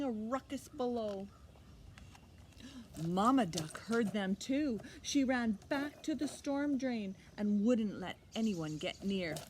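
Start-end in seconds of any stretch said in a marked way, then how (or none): none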